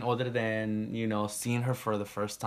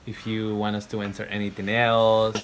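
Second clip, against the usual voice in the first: youtube outro; 10 dB louder than usual. Acted. Outro call to viewers.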